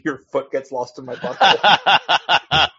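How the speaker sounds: slow, laughing delivery; cracking up. Real, not acted. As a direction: laugh your way through your own punchline. Cracking up at own absurd punchline.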